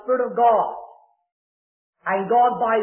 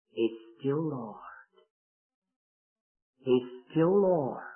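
faint, slow, fading whisper of a voice; barely able to speak, slow and soft; very weak voice; 7 semitones lower, 7 dB quieter, slower than usual. Retold or imitated. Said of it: "with a very weak voice".

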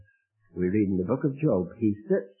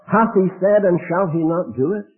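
loud, much higher proclamation; ringing scripture; 9 semitones higher, 7 dB louder than usual. Acted. Preacher quoting the Bible on God's promise, loud and high. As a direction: declaim it with full force. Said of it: "my Bible says of God".

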